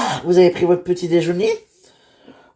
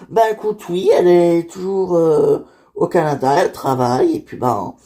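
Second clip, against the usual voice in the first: ordinary talk, nothing marked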